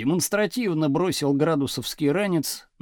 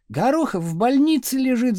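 higher-pitched cry of correction; girls' shout; 6 semitones higher than usual. A narrator's reading. Girls shouting to the teacher about a classmate, insistent cry. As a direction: call it out over the class. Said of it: "закричали девицы".

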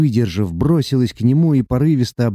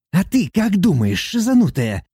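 higher, grumpy dwarf demon jeer; grumbling; 8 semitones higher than usual. A narrator's reading. Dwarf demon grumbling back at Sati, higher and jeering. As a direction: grumble a mocking retort. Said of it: "ворчливо отозвался тот".

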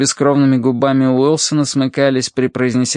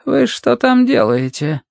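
high, slow, nagging question; thin, listless voice; 10 semitones higher than usual. A narrator's reading. Financier challenges Matthew in the corridor, thin listless nagging. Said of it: "Голос был тощий и вялый".

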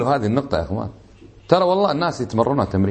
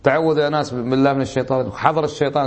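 ordinary delivery

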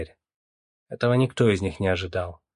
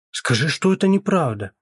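high, louder imploring plea; pleading; 7 semitones higher, 5 dB louder than usual. A narrator's reading. Mother begging her son to deny it, pleading. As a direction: beg him, voice raised. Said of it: "взмолилась мать".

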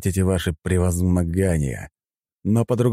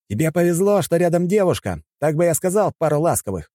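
high, raised retort; shouting back; 11 semitones higher than usual. A narrator's reading. Fighter snaps at the hero mid-fight, shouting back. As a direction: yell it back across the fight. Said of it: "крикнул он".

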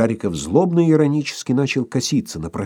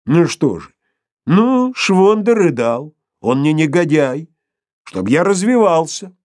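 high, loud, slow retort; defiant Sharikov; 6 semitones higher, 5 dB louder than usual. A narrator's reading. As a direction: justify yourself stubbornly. Sharikov defends Shvonder.

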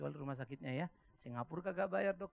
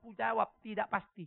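ordinary talk, nothing marked

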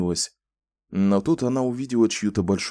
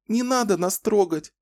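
put-on much higher, pleading child's voice; plaintive little girl; 12 semitones higher than usual. A narrator's reading. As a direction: beg pitifully. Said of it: "жалобно попросила Рэчел". (жалобная просьба девочки)

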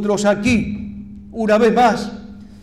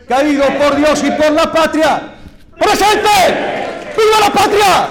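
shouted rallying cry, much higher and louder; ranting dictator speech; 8 semitones higher, 5 dB louder than usual. Real, not acted. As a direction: roar it to the crowd at the peak. Funeral oration climax, rallying cry.

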